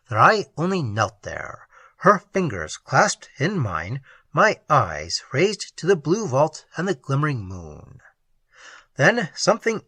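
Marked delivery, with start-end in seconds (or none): none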